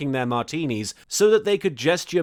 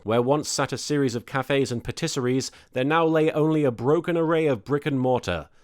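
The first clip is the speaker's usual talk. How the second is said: ordinary delivery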